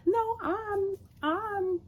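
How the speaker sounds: high, halting protest; mock defensive. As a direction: imitate someone stammering in self-defence. Acts her defending herself.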